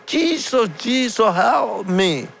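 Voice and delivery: very high imitated cry for help; retold loud plea. Retelling the tongue-tied man's plea, high retold cry. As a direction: plead high without really shouting. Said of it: "The only word that he said loud".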